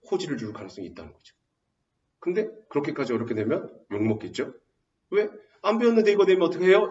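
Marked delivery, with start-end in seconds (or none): 5.1-6.9 high, quick mock protest; complaining student. Student objects to an untaught exam question, complaining. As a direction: imitate a student objecting fast. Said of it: "이렇게 말하겠죠".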